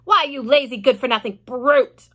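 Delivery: mild mock shout; shouting aunt. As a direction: shout insults at the boy. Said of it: "Aunt Spiker shouted".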